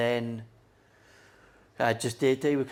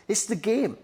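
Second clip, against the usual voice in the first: high mock dad; 9 semitones higher, 5 dB louder than usual. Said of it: "And he'd be like".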